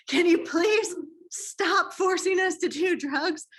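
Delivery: high, slow, hammed-up begging; mock exasperated plea. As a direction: play-act a desperate plea, stretched out and pitched high. Exasperated plea in acted exchange.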